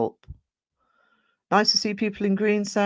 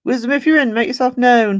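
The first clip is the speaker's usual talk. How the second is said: louder and higher, summoning a viewer; calling out loudly; 7 semitones higher, 10 dB louder than usual. Real, not acted. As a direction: call out to a missing friend in chat.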